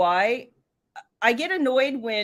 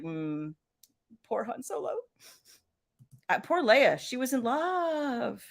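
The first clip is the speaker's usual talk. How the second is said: mock tearful sympathy; 6 dB quieter, slower than usual. Acting out childhood tearful sympathy.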